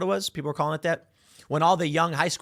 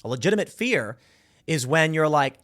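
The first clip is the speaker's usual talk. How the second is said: normal talking voice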